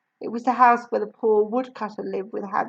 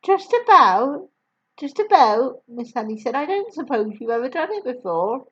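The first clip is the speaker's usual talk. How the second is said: put-on higher, louder gentle young woman; kind teacher voice; 3 semitones higher, 5 dB louder than usual. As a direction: warm young teacher reassuring. Miss Honey voiced.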